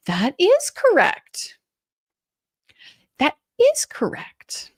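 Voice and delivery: high, slow, condescending sing-song. Retold or imitated. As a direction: talk down like to a kindergartner. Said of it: "she starts using her sing-song voice".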